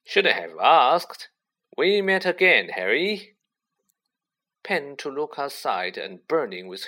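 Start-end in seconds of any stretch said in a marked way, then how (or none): 0.0-3.4 loud, booming joviality; jovial thundering. A narrator's reading. Lockhart with an arm round Harry, thundering jovially. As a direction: boom it out heartily, swooping up. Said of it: "thundered jovially".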